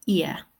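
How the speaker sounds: "ia" in RP, ending in a schwa, no R; RP diphthong. Shown as a demonstration. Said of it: "How would the king say this?".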